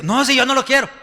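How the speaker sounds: mock denial. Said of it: "spouse in mock denial".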